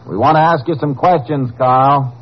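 high, carrying call; calling through door. Acted. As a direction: project it to someone shut inside.

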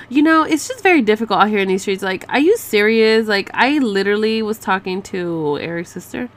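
normal talking voice

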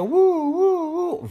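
high wailing "uh" like an ambulance; long rising siren howl; imitated siren.

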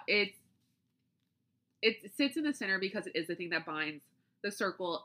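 usual speaking voice

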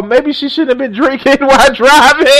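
high, loud, breaking into laughter; cracking up. Real, not acted. Streamer joking about the alcohol excuse, cracking up. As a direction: let the words burst into a big laugh.

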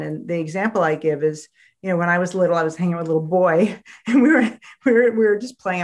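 ordinary talk

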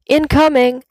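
high, loud warning shout; heads-up shout. A narrator's reading. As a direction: call it out across the rock. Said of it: "someone shouts".

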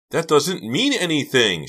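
high, loud frantic protest; crying out. A narrator's reading. Karina answers Stuart's evidence, frantic cry. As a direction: cry it out defensively. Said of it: "she cried".

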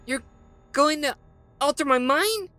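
frightened exclaim. Acted. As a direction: cry out in alarm. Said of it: "she exclaimed".

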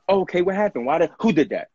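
high, halting run of urgent questions; alarmed questioning. Acted. Reacting to someone saying they were bothered, alarmed questioning. Acting out protective reaction to upset boy.

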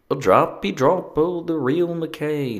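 high, slow, badly faked Scottish lilt; amateur Scottish accent, drawn out and high; terrible Scottish accent. Shown as a demonstration. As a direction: try Scottish again with the pitch way up. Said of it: "What is Scottish?".

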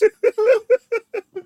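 shrieking laugh; cackling laugh; high burst of laughter. Real, not acted. Big laugh.

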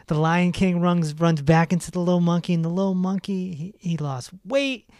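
ordinary talk, nothing marked

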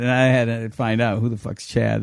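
ordinary talk

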